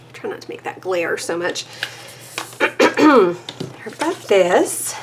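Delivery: ordinary delivery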